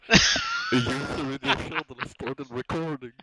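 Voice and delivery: mimicking a recording alert, slow and loud; loud automated announcement. Friend mimics the recording alert back to the recorder, loudly. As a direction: blare it out like a channel notification. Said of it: "it very loudly said".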